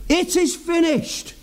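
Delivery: high-pitched victorious declaration without shouting; triumphant cry. Retold or imitated. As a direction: proclaim it as a victory won. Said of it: "with triumph in his voice".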